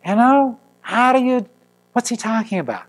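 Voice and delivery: high bewildered protest; exasperated. Real, not acted. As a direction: throw up your hands at it. Exasperated at scripture.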